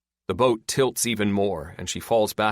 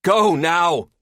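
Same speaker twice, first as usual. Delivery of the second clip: high, loud command; yell; 10 semitones higher, 8 dB louder than usual. A narrator's reading. Hurt woman sending Linda after Lester, yelling. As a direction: yell it as an urgent order. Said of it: "Terry yells at Linda".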